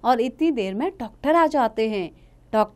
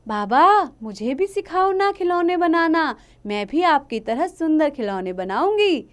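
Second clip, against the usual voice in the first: put-on higher, pleading little girl voice; 7 semitones higher, 4 dB louder than usual. Daughter Lakshmi begs her father to teach her, pleading. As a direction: coax her father sweetly.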